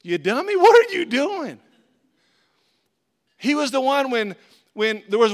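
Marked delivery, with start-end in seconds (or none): 0.0-1.6 exasperated, higher and louder at Peter; a playful rebuke rising to a yell; mock scolding. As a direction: scold Peter like a frustrated friend. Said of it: "I'm like".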